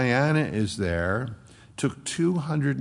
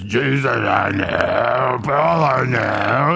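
slow, louder shouted demon snarl; unfriendly demon voice; 7 dB louder, slower than usual. Acted. Demon roaring at the seven exorcists, slow and hostile. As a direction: roar it out slowly like a monster. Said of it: "you can imagine this wasn't a friendly sound".

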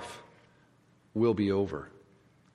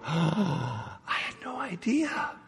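amazed realization; 3 semitones higher, 8 dB quieter, slower than usual. Pretend. Believers realizing in heaven.

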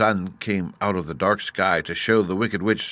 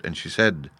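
usual speaking voice

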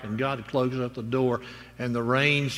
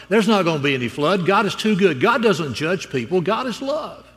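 dismissive father; 6 semitones higher, 6 dB louder than usual. Acted. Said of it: "And the father laughs and says".

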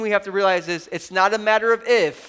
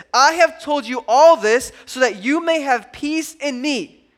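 louder, higher, slow preaching of the verse; fervent scripture reading; 4 semitones higher, 5 dB louder, slower than usual. Acted. Pastor reading Jesus' words to the church, fervent and rising. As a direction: proclaim the promise with full force.

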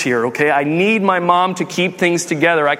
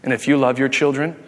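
deadpan mom; 4 semitones lower than usual. Retold or imitated. Mom finally answers her accusing child, deadpan. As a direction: deliver it dead flat, as the unmoved mother. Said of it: "deadpan face, and she is, she says this".

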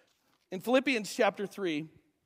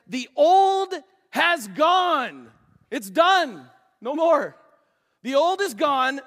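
slow, loud, higher shout of the verse; proclaimed shout; 4 semitones higher, 7 dB louder, much slower than usual. Acted. Preacher crying out scripture to his church, shouting. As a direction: thunder the old has gone.

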